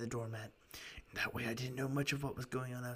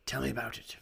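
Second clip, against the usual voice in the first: whisper; 5 dB louder than usual. Acted. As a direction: breathe the line. Whispered line in reading.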